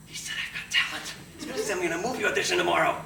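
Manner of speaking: fast, loud giddy retelling; breathless excited boast; thrilled. Retold or imitated. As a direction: bubble over with the good news.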